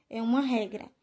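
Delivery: normal talking voice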